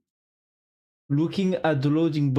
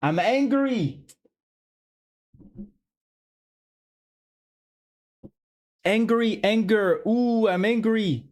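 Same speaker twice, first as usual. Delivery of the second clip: hammed-up cross voice; play-acted anger; 5 semitones higher than usual. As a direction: pretend to be angry, pitched up. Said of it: "Uh, angry".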